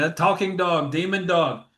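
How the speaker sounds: lightly hammed-up alarm; mock freak-out. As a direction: pretend to flee a talking dog. Imitating freaked-out mourner running out.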